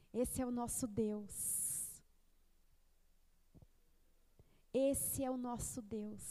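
low, slow and hushed; solemn affirmation. Real, not acted. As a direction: let each word land softly, with weight.

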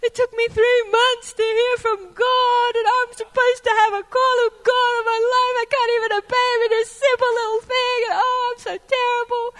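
loud, high-pitched self-pitying wail; hammed-up despair, whining loudly at yourself; mock wailing. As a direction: wail it out like an overdramatic penitent. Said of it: "I felt really bad".

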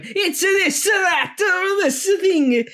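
mocking, hammed-up Italian accent, shouted high; fake Italian accent. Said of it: "trying to do a fake Italian accent".

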